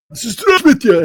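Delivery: high, loud crowd greeting; addressing twenty-five thousand soldiers, booming. Retold or imitated. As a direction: hail the whole crowd at once. Greeting 25,000 soldiers at microphone.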